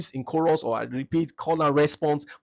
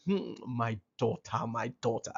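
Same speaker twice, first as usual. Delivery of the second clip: tender fatherly voice; 5 semitones lower, 6 dB quieter than usual. Shown as a demonstration. Father tenderly telling his daughter she is his. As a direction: croon it gently to your daughter. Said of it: "a romantic voice from a father telling".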